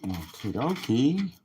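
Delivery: ordinary delivery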